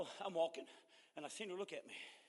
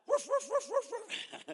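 mock dog barks; 13 semitones higher than usual. Imitates the dogs barking.

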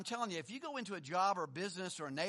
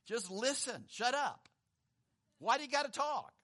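God's voice from heaven; 4 semitones higher than usual. Retold or imitated. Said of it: "this voice comes from heaven".

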